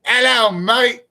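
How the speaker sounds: high-pitched cockney greeting; too-cockney British. Acted. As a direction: overdo the cockney. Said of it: "It's too cockney".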